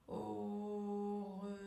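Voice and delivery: AU REVOIR very slow, each syllable held on a level tone; stretched syllables. Shown as a demonstration. Coach has learners repeat along, drawn-out AU REVOIR. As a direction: draw out AU, RE and VOIR evenly, almost chanted. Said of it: "allonger le mot, allonger la syllabe".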